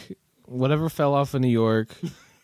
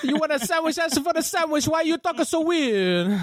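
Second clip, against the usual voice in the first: very high cartoon Italian accent; hammed-up Italian meatball guy, pitched way up; old-time Italian shopkeeper. Italian shop owner questioning a customer's accent, high exaggerated mock Italian. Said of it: "early nineteen hundreds Italian shop owner".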